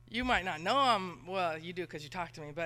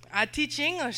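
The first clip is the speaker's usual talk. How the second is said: light Mexican accent, pitched higher; 7 semitones higher, 6 dB louder than usual. Shown as a demonstration. Missionary mimicking her Mexican students, a light Mexican accent. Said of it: "Mexican kids that speak like this".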